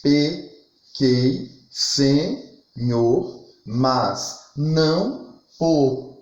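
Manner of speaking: each syllable slow and separate, pauses between; drawn-out syllables with clear gaps; syllable-by-syllable scansion. Shown as a demonstration. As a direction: scan the verse aloud beat by beat. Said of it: "Então, ficaria assim".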